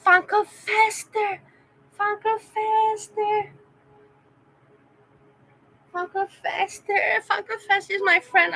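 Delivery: delighted calling. Real, not acted. Greeting a visiting fan, delighted.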